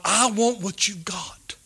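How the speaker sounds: a hushed, envious murmur; covetous whisper. Acted. Preacher voicing the covetous heart to the congregation, whispered. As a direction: whisper it with greedy longing.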